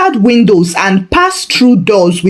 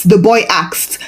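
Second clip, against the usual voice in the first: usual speaking voice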